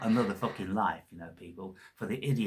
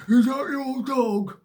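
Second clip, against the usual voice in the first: high, slow put-on voice of a doddering old bastard; mock old codger asking; grumpy old man; 10 dB louder, slower than usual. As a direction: play the nosy old man in a much higher voice. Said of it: "said to me".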